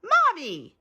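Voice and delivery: put-on high, loud child's gasped protest; falling, high-pitched outcry; shocked. A narrator's reading. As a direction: exclaim it in scandalized shock. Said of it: "Petey was shocked".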